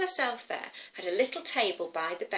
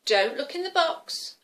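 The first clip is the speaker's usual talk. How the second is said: higher, firmly voiced warning; stern grandma; 6 semitones higher, 4 dB louder than usual. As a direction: tell the child off firmly. Grandma scolds the girl.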